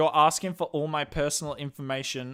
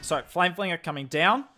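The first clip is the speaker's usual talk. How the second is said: louder, higher caster voice; live esports play-by-play; 5 semitones higher, 6 dB louder than usual. Real, not acted. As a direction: cast the attack live with mounting energy at big moments. Casts the attack live for stream.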